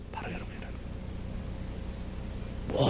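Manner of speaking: hushed whisper of the point; sermon whisper. Acted. Pastor whispering the key point to the congregation. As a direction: drop to a breathy whisper for effect.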